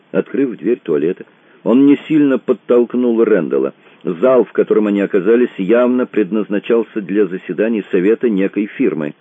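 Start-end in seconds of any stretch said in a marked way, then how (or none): none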